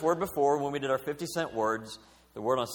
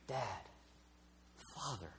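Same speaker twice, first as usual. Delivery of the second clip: tender address; 3 semitones lower, 19 dB quieter than usual. Retold or imitated. Sons addressing their father.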